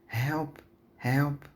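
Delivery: high-pitched cry for help, only half-shouted; storybook scream, understated; mild narrated scream. A narrator's reading. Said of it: "Roxanne screams from upstairs".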